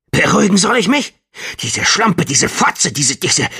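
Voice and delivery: high, loud furious rant; angry. Acted. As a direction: spit the insults out in rage. Furious man rants at patient.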